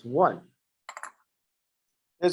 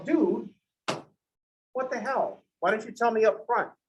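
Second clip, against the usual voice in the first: exasperated; 6 semitones higher than usual. Acted. Acted complaint at the punctuation.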